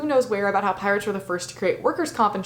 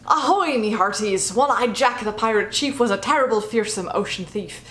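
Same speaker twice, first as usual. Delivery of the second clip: hammed-up pirate accent reciting a poem; mock pirate accent. Reciting the One-Eyed Jack poem in a mock pirate accent. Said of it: "my terrible pirate accent".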